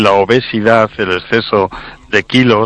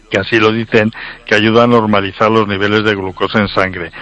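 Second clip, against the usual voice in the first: usual speaking voice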